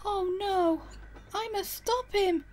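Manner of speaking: very high, loud dismay; gasping alarm. Acted. Emily learns James has the faulty coaches, alarmed. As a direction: exclaim in urgent alarm. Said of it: "Emily gasped".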